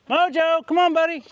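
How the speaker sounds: high, loud call to the dog. Real, not acted. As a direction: call him from across the yard. Calling the dog from afar.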